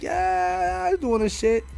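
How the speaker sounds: high falsetto drag queen voice; camp, high-pitched diva; mocking the drag host up high. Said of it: "she's all like".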